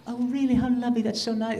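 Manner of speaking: imitated gracious actress. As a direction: mimic a star graciously taking a compliment. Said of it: "And she, of course, was".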